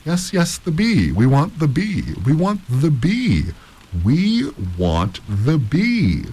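high, drawn-out chanting demand; crowd clamor. A narrator's reading. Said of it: "The whole room took up the cry".

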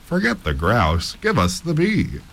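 drawn-out call, jumping high; heckler calling out. A narrator's reading. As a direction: heckle from the back of the hall. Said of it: "called out".

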